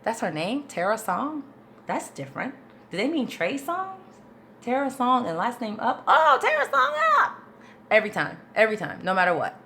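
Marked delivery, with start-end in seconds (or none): 0.0-6.0 higher, questioning mock confusion; acted-out bafflement with rising ends; puzzled thinking aloud. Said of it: "that mental process". 6.1-7.4 very high, squealed "oh, I get it"; mock eureka in a piercing high voice; sudden realisation. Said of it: "that mental process".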